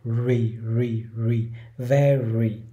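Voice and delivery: "re, re, re" with rounded lips, then "very"; rounded middle r. Shown as a demonstration. Teacher drills the r in very. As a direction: practise the middle r in "very" with pouted lips. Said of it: "Âm R ở giữa thì mình cũng chu môi".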